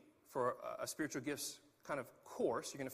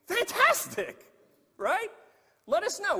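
much higher, much louder, slowly stretched fantastic; excited welcome; 11 dB louder, slower than usual. Real, not acted. Pastor inviting visitors to join, thrilled and loud. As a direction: burst with delight at newcomers. Enthusiastic welcome to non-members.

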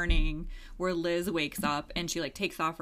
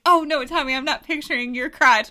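high, loud, flustered protest; mock dismay; 8 semitones higher, 9 dB louder than usual. Podcast host retelling her reaction, higher and louder in mock alarm. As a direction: throw up your hands in a pretend panic. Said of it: "But then I was like".

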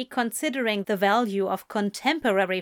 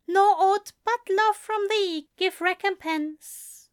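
higher angel voice, reciting; reciting poem; 11 semitones higher than usual. A narrator's reading. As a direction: lift the lines like a vow.

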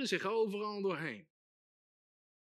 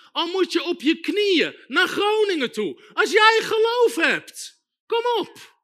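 loud, high preacher's shout; impassioned exhortation; 8 semitones higher, 9 dB louder than usual. Real, not acted. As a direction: urge the congregation on at full force. Preacher urging the congregation.

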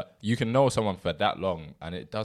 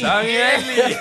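high laughing name; 11 dB louder, slower than usual. Real, not acted.

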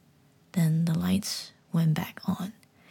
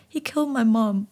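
tearful whispered sob of "He killed my mom"; boy sobbing to the frog; crying; 3 semitones higher, 5 dB louder than usual. Acted. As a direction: choke the words out through tears. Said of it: "I cried".

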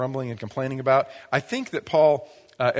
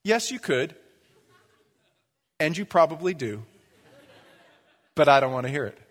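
unspoken retort. Pretend. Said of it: "What I think is".

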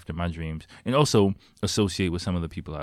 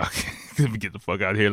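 fast, rushed mutter to yourself; hurried get-me-out; faster than usual. Retold or imitated. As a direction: rattle it off like you're already leaving. Deciding to flee the shady scene.

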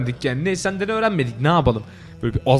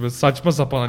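normal talking voice

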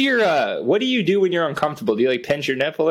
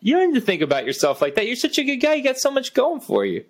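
high, sing-song encouragement; mock cheerleader pep talk; 6 semitones higher than usual. Friend hams up pitched-up praise for his gloomy friend. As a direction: hype up your friend with a pitched-up fake cheer.

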